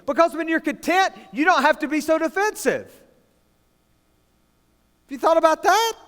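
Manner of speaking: normal talking voice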